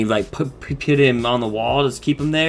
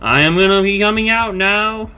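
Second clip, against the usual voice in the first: higher-pitched announcing voice; acted character line; 8 semitones higher, 3 dB louder than usual. Retelling a cartoon character's entrance, high theatrical announcement.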